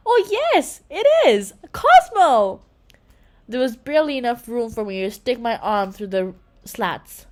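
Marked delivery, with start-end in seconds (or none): 0.1-2.6 higher and louder, thrilled; overjoyed. A narrator's reading. Girl finding her lost slime pet, overjoyed cry. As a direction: burst out with relief and joy.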